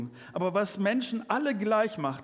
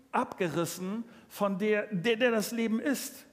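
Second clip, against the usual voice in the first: forceful preaching; 4 semitones higher, 4 dB louder than usual. Acted.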